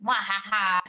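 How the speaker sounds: hammed-up villain cackle; mock evil laugh. Evil laugh after "I even have one.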